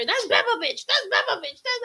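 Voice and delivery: high, loud mock announcement; imitating tipsy friend's bragging. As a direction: crow it like a tipsy hype friend. Tipsy homegirl announcing her to strangers.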